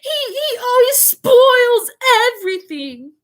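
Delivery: very high, loud, slow pretend sobs; fake sobbing yell. A narrator's reading. Dudley faking sobs at mother, high wailing. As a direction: wail it like a spoiled child faking tears. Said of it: "huge pretend sobs".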